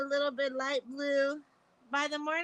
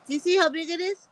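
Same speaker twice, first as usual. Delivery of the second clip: loud, fast and eager, showing off the bump; loud, fast excitement about a big bump; excited; 3 semitones higher, 10 dB louder, faster than usual. Real, not acted. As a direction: pushes it out quickly and loudly, thrilled by the bruise. Showing off her bruised head.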